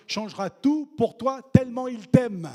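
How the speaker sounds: preacher's swelling cry; rising to a shout. Acted. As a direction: build to a ringing climax. Sermon climax.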